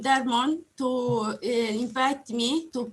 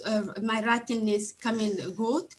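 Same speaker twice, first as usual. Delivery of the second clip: ordinary talk, nothing marked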